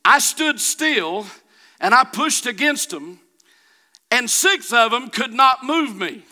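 high, loud testimony building in intensity; fervent preaching. Real, not acted. Preacher telling congregation six linemen couldn't move him, mounting fervor. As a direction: drive the story home, rising to a shout.

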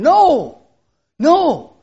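a loud, high shout of "No!"; a ringing pulpit denial; emphatic no. Real, not acted. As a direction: cry out against the doubt. Preacher rejecting despair.

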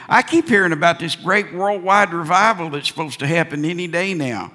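ordinary talk

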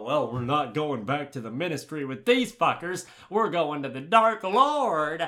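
loud put-on villain voice, slowing at the end; hammed-up villainous announcement; gloating snatcher. Imitating snatchers with their captives, gloating villain. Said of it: "and they go".

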